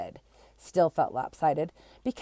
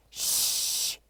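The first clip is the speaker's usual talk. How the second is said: sharp unvoiced shush; 4 dB quieter than usual. Class shushing Kiko with a whispered shh. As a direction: hush them with a whispered shh. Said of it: "said the class".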